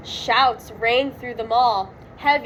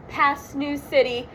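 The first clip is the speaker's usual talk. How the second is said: ordinary delivery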